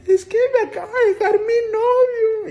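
hammed-up weepy lament; mock crying lady. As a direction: drag it out, sobbing like a jilted señora. Lady crying over her boyfriend.

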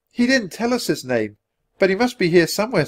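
put-on high, light child's voice; little girl. A narrator's reading. Annie answering the centurion, girlish.

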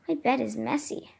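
ordinary talk, nothing marked

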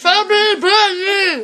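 slow, mushy words round a full mouth; cheeks stuffed full. Real, not acted. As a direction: push the words out past the marshmallows. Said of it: "I will do the chubby bunny challenge".

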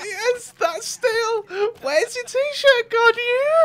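high, slow, loud gloating; gloating shout. Real, not acted. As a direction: crow over the stolen shirt. Gloating over stolen shirt.

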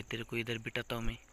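ordinary talk

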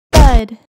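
one short, loud burst; loud thud. Acted. Scary movie noise for young readers, sudden loud thud. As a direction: hit it like something heavy landing. Imitated movie sound effect.

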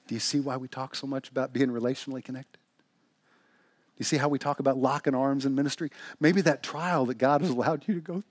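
usual speaking voice

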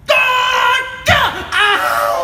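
high, loud exasperated yell ending in a yelp; hammed-up anger. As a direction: burst out in comic fury. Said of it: "she makes me so angry".

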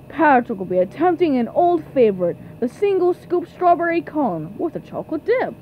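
ordinary talk, nothing marked

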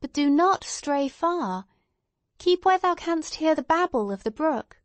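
a hint of motherly insistence; Hester Prynne calling out. A narrator's reading. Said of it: "cried Hester Prynne".

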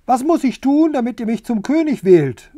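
high, loud urgent question; shouting. A narrator's reading. Rooster demanding votes at the pigsty, shouting high. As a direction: shout it across, pitched well up. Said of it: "schrie er".

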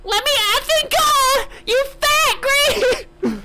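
put-on squeaky little boy shouting at Shrek; high kid voice. Shown as a demonstration. Redo the line to Shrek in a high kid voice. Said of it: "let me say that again".

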